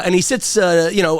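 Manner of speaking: ordinary delivery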